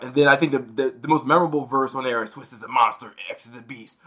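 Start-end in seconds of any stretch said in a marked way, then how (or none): 2.3-4.0 lower, softer rap quote; low part. Retold or imitated. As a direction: drop it down low before the build. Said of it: "they bring it low".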